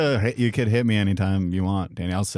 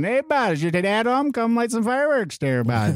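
put-on high-pitched trashy Vermont "Hey, bud" accent; South Dakotan Canadian accent; 11 semitones higher than usual. Vermont accent, doing a trashy local for hosts. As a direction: ham up a backwoods Vermont neighbor, pitched way up. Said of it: "kind of a South Dakotan Canadian".

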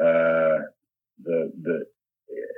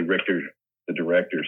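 usual speaking voice

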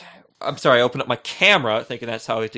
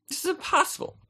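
mock disbelief; 7 dB quieter than usual. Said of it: "I'm like".

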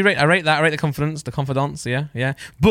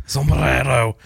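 mock Spanish accent; much slower than usual. Shown as a demonstration. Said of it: "I'm trying".

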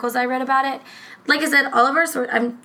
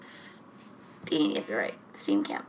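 quiet retry of name; 12 dB quieter, much slower than usual. Shown as a demonstration. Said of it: "I think it's Steenkamp".

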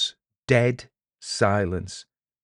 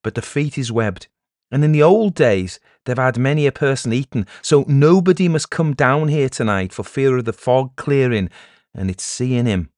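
raised, emphatic village warning; stern village elder; 5 semitones higher, 7 dB louder than usual. Acted. Warning villagers away from the foggy sea, stern and raised. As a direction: warn them off with weight on key words.

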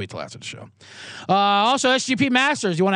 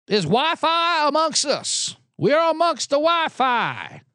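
mock senator; 9 semitones higher, slower than usual. Mocking confused senators.

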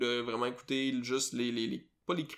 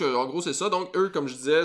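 ordinary delivery

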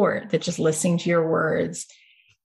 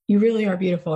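usual speaking voice

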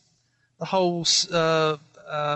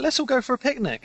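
mocking carefree voice; 10 semitones higher than usual.

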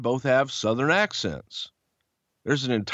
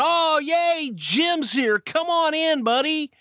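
much higher, gushing sarcastic greeting; mock welcome; 13 semitones higher, slower than usual. Company sarcastically welcoming Jim, gushing mock greeting. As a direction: fake a cheery over-the-top welcome.

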